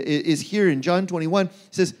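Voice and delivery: ordinary talk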